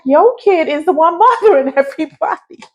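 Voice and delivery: high laughing comeback; laughing retort. Retold or imitated. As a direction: laugh it out at the parent. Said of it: "I be like".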